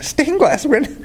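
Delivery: high, amused disbelief; half-laughing in disbelief; stunned you'd pick the windows; incredulous. Retold or imitated. Pastor's incredulous inner reaction.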